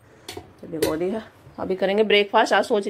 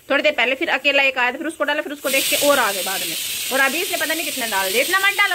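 worked-up complaint; 7 semitones higher, 5 dB louder than usual. Real, not acted. Complaining about a kid overfeeding pigeons.

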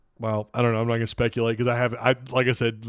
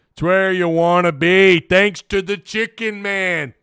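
higher, slow, drawn-out slogan delivery; put-on slogan voice; 4 semitones higher, slower than usual. Streamer echoes a slogan about Central Arkansas, hammed-up drawn-out voice. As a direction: ham it up like a catchphrase, stretching the words.

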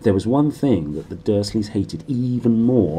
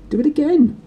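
much higher, louder demanding command; bossy Dudley order; 6 dB louder than usual. A narrator's reading. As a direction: order it like a spoilt child. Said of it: "Dudley ordered".

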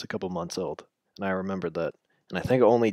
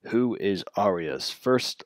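slow, drawn-out reading; slow drawl; slower than usual. Acted. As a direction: stretch each word slowly. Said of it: "just talk normally me".

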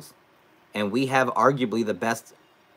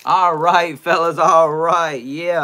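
loud return; 8 semitones higher, 9 dB louder, slower than usual. Real, not acted. Returning to stream loudly.

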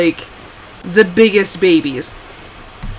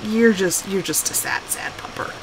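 put-on baby talk; 4 semitones lower, 9 dB quieter than usual. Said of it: "And I'm like".

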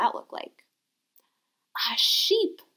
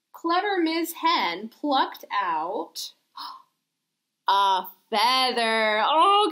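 ordinary talk

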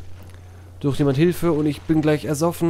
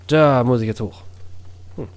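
usual speaking voice

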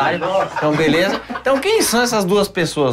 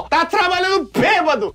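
mock scolding; 10 semitones higher than usual. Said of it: "o pessoal fala lá, ó".

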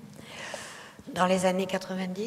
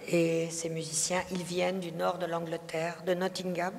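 usual speaking voice

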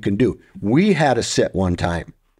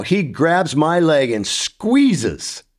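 usual speaking voice

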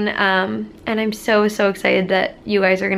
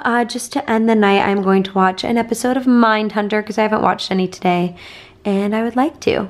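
normal talking voice

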